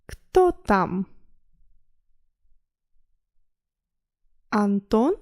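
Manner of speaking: slow, carefully separated words for learners. Shown as a demonstration. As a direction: read it slowly, pausing to allow repetition. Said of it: "a little slower".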